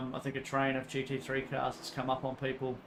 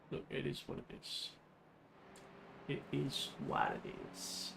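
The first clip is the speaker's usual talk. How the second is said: resigned whisper; 9 dB quieter than usual. Real, not acted. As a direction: breathe it out, shrugging it off. Resigned aside after race setback.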